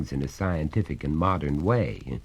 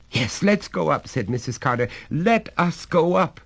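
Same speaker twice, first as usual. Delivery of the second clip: a touch of urgency, higher; lightly voiced anxious mother; 11 semitones higher, 4 dB louder than usual. Mother urging the women to go up, mildly anxious. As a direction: hurry them upstairs.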